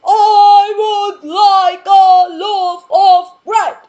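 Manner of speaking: very loud, high, slow sung request; booming giant voice. Acted. Mister Noisy booming his order at the butcher. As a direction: boom it out, dragging every word. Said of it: "says in his voice".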